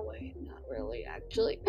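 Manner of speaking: a secret aside to the audience; whispered aside. Real, not acted. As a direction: whisper it under your breath. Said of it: "whispered aside".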